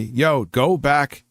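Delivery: ordinary delivery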